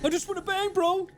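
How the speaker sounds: whiny gamer bro. Pretend. Streamer mocking a gamer who just wants to shoot. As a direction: whine it like a frustrated gamer bro.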